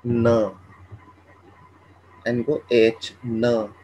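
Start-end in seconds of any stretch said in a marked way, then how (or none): 0.1-0.5 न, short and quiet; Chinese n as na. Shown as a demonstration. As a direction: say न softly, plain n plus a. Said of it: "एन को pronounce करते हैं न".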